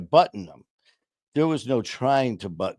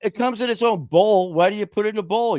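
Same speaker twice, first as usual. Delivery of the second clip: excited mock lecture; 9 semitones higher than usual. Retelling friend's ice cream logic.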